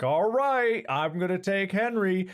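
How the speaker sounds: singsong heads-up, pitched high; announcing to the dog. Retold or imitated. Owner teasing the dog about a walk, calling out.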